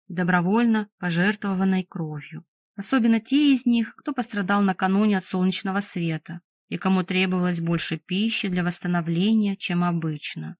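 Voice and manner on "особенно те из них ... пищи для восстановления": plain reading voice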